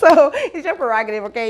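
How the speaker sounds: words spill out through a laugh. Real, not acted. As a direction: laugh while saying it, quickly. Laughing after reading lyric.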